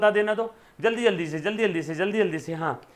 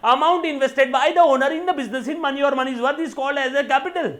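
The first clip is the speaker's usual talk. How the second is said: each word spaced out for notes; slow classroom dictation; 4 semitones higher, 4 dB louder, much slower than usual. Acted. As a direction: read it slowly so students can write. Dictating a definition slowly.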